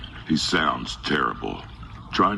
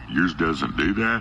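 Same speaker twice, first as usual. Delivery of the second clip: ordinary delivery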